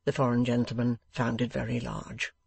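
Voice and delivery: ordinary delivery, nothing marked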